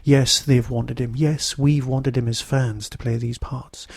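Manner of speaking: ordinary delivery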